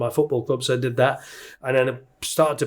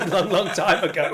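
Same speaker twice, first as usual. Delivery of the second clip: laughing; 5 semitones higher, slower than usual. Real, not acted. Joking about Vikings.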